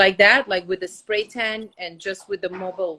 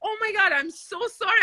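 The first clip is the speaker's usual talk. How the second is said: higher, hurried mock apology; flustered apology; 10 semitones higher than usual. Host retelling her apology to her friend, flustered. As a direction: gush a flustered sorry. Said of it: "I'm like".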